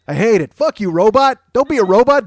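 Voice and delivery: normal talking voice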